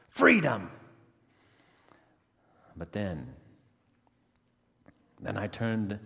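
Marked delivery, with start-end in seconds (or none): 0.1-0.7 loud, high cry of release; triumphant shout. Acted. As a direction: yell it to a wild crowd. Freed prisoner goes wild.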